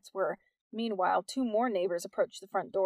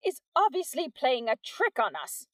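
theatrical; 4 semitones higher, 3 dB louder than usual. A narrator's reading. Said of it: "she said theatrically".

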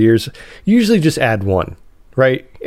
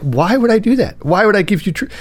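put-on defiant young lady; 9 semitones higher than usual. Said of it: "And she's like".

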